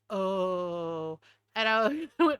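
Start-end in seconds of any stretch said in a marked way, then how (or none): 0.1-1.2 low, drawn-out "oh"; imitated chastened "oh". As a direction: imitate him, sinking into a long low "oh". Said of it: "like that".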